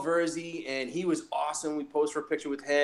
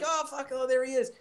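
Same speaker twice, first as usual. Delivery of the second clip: higher, hushed thrill at spotting him; a touch of starstruck excitement; excited whisper-ish; 10 semitones higher than usual. Retold or imitated. Starstruck reaction retold.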